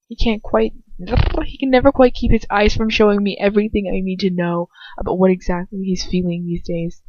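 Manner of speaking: ordinary delivery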